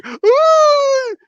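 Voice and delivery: high, loud cowardly shriek; mock scream. Mocking a man who cowers at a break-in, high shriek.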